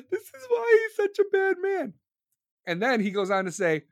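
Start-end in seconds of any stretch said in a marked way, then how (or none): 0.0-1.9 very high mock-hysterical wail; high caricature of a scandalized liberal; whiny outraged reader. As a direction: squeal in exaggerated disbelief. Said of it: "These ignorant Dem motherfuckers are probably reading this, be like".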